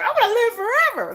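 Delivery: high gleeful declaration; gleeful boast. Retold or imitated. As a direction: announce it with glee. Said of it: "Tell our kids that".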